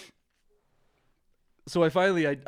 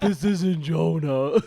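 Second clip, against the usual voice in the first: mock disguised voice; 3 semitones higher, 8 dB louder, much slower than usual. Prank caller denies being Jonah.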